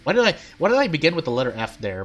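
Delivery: ordinary talk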